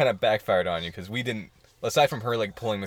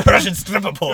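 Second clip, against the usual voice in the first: put-on Russian accent; 7 semitones higher, 8 dB louder than usual. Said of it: "I thought you were saying that in a Russian accent".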